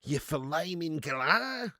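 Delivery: very slow, stretched-out Australian mock insult; milk every vowel in broad Aussie; Aussie impression. Streamer hamming up a joke insult, extremely drawn-out Australian accent. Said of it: "Do an Aussie impression?".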